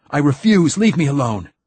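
high, loud panicked refusal; desperate shout. A narrator's reading. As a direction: yell to keep the creature off. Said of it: "cried Martin Silenus".

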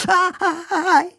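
very high yelps of pain; howling in pain. A narrator's reading. Boy faking agony as doctor touches his belly, howling. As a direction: cry out ow at the lightest touch. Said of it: "hurlai-je".

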